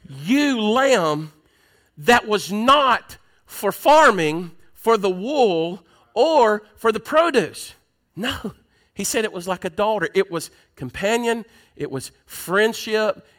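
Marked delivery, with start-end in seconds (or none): none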